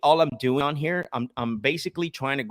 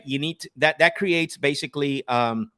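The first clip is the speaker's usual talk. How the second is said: ordinary delivery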